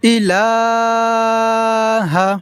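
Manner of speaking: ইলাহা with the লা held very long; slow chanted recitation; elongated ইলাহা. Shown as a demonstration. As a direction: stretch লা in ইলাহা. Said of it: "টেনে পড়তে হবে এইভাবে".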